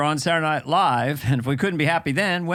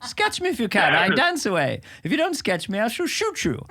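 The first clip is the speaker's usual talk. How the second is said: lightly voiced haughty prince; a hint of a prancing royal taunting; prince catchphrase; 6 semitones higher than usual. Recalling his dancing prince sketch to a friend, haughty taunt. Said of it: "that was the one".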